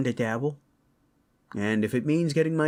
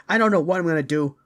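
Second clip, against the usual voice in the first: loud, high, rapid outburst; heated, rushing burst of anger; worked-up threat; 9 semitones higher, 8 dB louder, much faster than usual. Acted. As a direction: rant it fast with the voice raised. Worked-up parent threatens the kidnapper.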